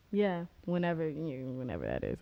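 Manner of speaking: ordinary talk, nothing marked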